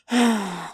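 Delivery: imitated exasperated sigh; frustrated sigh. She sighs after he walks out, frustrated sigh. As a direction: let out a wordless, frustrated breath. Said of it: "she's still frustrated".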